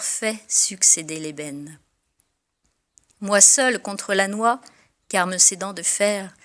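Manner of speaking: lyrical verse reading with savoured pauses; fond, measured recital of verse; poem recitation. Acted. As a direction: recite the ode to coffee with relish.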